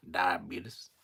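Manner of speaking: "Diabetes" as die-uh-BEET-us; mimicked die-uh-BEET-us for "Diabetes"; Wilford. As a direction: imitate "Diabetes" ending in BEET-us. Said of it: "the way he said it".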